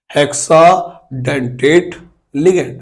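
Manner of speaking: Hexadentate broken into slow syllables; slow dictation. Real, not acted. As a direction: dictate hexadentate piece by piece for note-takers. Dictating new term to students. (Hexadentate को टुकड़ों में धीरे-धीरे लिखवाते हुए)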